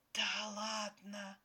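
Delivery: slow, drawn-out delighted disbelief; awed exhale. A narrator's reading. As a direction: breathe it out in admiration. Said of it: "восхищённо выдохнула Ксюшка".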